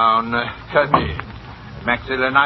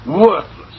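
ordinary delivery

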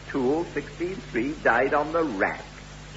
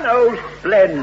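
high, loud burst of delight; 7 semitones higher, 8 dB louder than usual. Acted. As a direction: exclaim with sudden pleased relief. Buyer delighted at sudden deal.

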